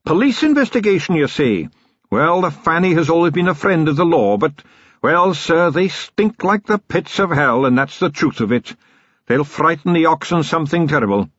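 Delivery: put-on gruff old riverman warning; a hint of a worried bargeman; Sillitoe the boatman.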